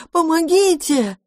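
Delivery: отчаянный зов, гораздо выше и медленно; кричать о помощи изо всех сил; крик ужаса. A narrator's reading. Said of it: "закричал осипшим от ужаса голосом". (отчаянный крик, высоко)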